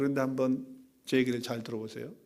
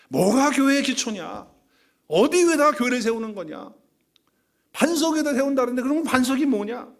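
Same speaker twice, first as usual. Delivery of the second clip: fiery preaching; 8 semitones higher, 5 dB louder than usual. Acted. Pastor pressing the congregation about the church's foundation, fiery preaching. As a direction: challenge the listeners with each question.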